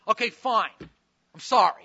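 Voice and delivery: loud, curt grudging apology; insincere apology. Shown as a demonstration. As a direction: spit out a sorry you don't mean. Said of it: "What does that sound like?".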